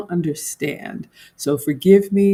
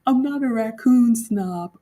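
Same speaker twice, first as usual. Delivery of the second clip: normal talking voice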